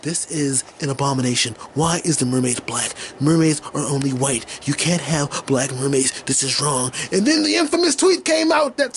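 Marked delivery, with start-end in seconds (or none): 0.0-6.9 lower, softer mock bigot voice; a hint of a whiny bigot. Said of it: "voicing racist complainers".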